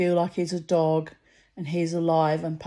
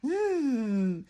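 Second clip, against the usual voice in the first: sneering hmm; 6 semitones higher than usual. Retold or imitated.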